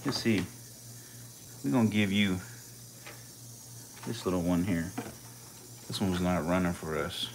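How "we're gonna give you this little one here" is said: normal talking voice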